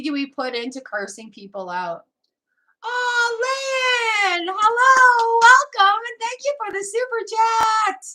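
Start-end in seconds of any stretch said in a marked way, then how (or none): 2.8-8.0 high, loud squeal of welcome; excited greeting. Real, not acted. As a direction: greet a donor in delighted, drawn-out high cries. Streamer greets a donor.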